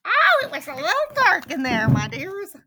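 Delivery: quick, high, loud granny; rescued granny. A narrator's reading. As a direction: exclaim high and fast as the freed granny. Freed Granny speaks.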